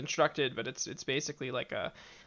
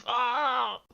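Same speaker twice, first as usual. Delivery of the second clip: big relieved ah after a drink; refreshed gasp; 9 semitones higher, 3 dB louder than usual. Acted. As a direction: exhale with relief.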